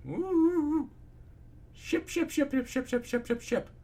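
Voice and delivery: very high squealed chant; excited squeal. Acted. Reader breaks into shipping chant as Twilight blushes, high squeal.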